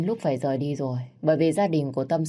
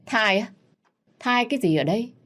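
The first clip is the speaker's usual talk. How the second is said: higher, scoffing disbelief; mocking scorn; 7 semitones higher than usual. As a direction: sneer at the idea of a baby. Mocking the pregnancy claim.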